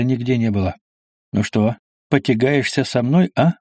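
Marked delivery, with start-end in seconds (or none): none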